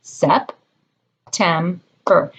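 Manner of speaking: September said slowly, each syllable separate; Sep, tem, ber, one clap per beat; clapped syllables. Shown as a demonstration. Teacher leading a clapping game, slow syllables. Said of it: "How many claps were there".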